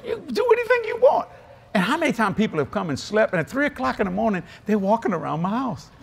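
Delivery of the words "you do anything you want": excited welcome. Retold or imitated. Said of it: "I said".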